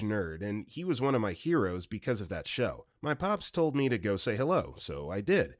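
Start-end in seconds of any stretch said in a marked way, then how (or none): none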